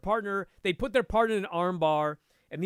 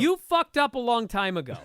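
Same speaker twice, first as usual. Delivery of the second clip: a louder, higher take on the blunt coach; Kurt Osiander voice; 5 semitones higher, 5 dB louder than usual. Retold or imitated. Quoting the coach's verdict to a stuck student, louder. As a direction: bark it like the blunt instructor. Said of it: "he always said it like Kurt Osiander".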